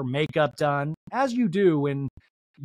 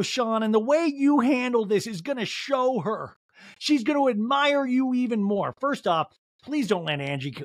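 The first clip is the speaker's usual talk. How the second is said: ordinary talk, nothing marked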